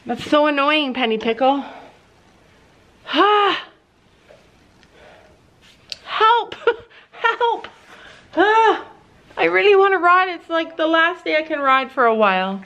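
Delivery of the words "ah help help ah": mock wail. Real, not acted. Stuck buckle, playful despair.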